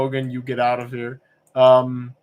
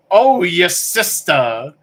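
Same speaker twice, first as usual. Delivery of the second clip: louder, very slow, pained cry with a higher pitch; Sopranos heart-attack line; 5 semitones higher, 8 dB louder, much slower than usual. Retold or imitated. Retelling the Sopranos shower scene to viewers, slow stricken cry. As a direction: clutch your chest and groan it out. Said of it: "clutches his chest ... and says".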